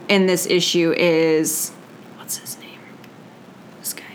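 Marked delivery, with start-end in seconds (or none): 2.2-4.0 whispering. Real, not acted. Whispering while trying to recall.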